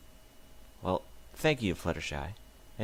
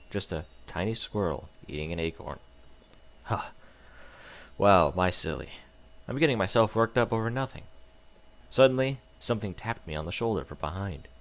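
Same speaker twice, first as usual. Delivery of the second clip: usual speaking voice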